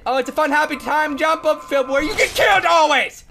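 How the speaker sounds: loud, high, hammed-up glee; mock cheerful shout. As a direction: shout a manic fake sales pitch. Mock answer as dad to son.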